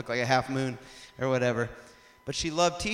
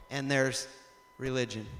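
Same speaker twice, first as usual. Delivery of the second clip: ordinary delivery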